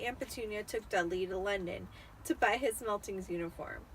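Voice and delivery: ordinary delivery, nothing marked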